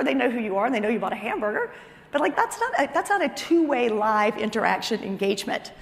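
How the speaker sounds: usual speaking voice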